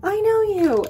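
high, loud thrilled "I know you"; excited recognition. Retold or imitated. Meeting online friends at show.

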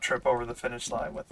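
ordinary talk

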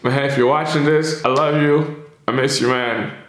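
choking out I love you through tears; crying. Real, not acted. Telling late friend Corey he loves him, crying. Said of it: "before I cry even more".

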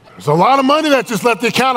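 high, fast, loud comic protest; hammed-up alarm at missing money; mock outraged wife. Wife confronting husband over the drained account, mock outrage.

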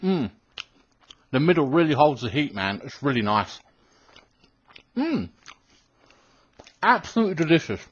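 talking with mouth full; eating between words; chewing while talking. Real, not acted. Seen 4: Eats cookie before; jaw keeps chewing between phrases throughout.

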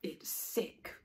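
whispered verdict. A narrator's reading. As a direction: hiss it under your breath. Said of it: "said Naty".

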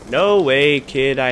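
loud outraged cry of disbelief; shout of disbelief. Real, not acted. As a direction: yell it as the kill slips away. Killed by enemy player.

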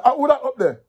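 high, loud shout up the tree; soldier's call. Acted. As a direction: demand who's hiding up there.

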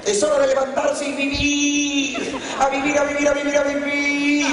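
high, sustained chanting holler, imitating mom; ostentatious wake-up cry. Mother rousing her children to get up and live, ostentatious high cry. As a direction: call it out on one high, drawn-out note.

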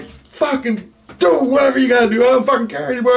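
high, frantic outburst; going nuts. Retold or imitated. Giving up in the alien's face, going nuts. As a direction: throw it out wildly, at the end of your rope. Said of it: "I was going nuts".

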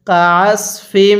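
কাসফিম drawn out slowly; slow recitation. Shown as a demonstration. Teacher reciting for students to repeat. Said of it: "আমার সাথে পড়ুন".

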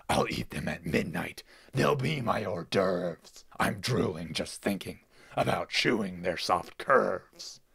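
loud, greedy villain relishing the feast; gloating pig villain. Acted. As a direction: boom out like a hungry pig lord. Pig villain gloats over ears.

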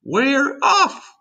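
high, loud joyful yell; excited group cry. A narrator's reading. The others answering the centipede, excited cry. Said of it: "the others cried".

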